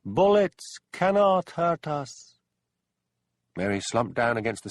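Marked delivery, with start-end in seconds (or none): 0.0-2.4 high, loud, very slow otherworldly jeer; jeering ghostly voice. A narrator's reading. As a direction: sneer down at the gunman from nowhere. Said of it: "whispering, jeering voice again".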